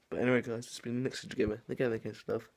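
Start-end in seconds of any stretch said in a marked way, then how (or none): none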